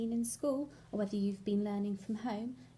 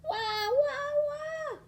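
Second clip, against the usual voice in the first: very high mock toddler wah; bad-tempered wailing; 6 dB louder, much slower than usual. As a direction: bawl like a cross toddler. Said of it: "screaming and sobbing".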